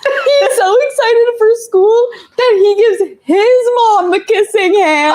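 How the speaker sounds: high, tearful, halting voice, real tears; crying while reading. Real, not acted. Host tells viewers she is really crying while reading the mom's words. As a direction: let the tears break through the words. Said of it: "she's actually crying".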